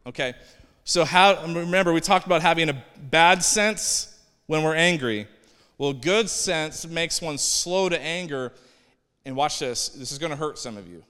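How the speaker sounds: ordinary talk, nothing marked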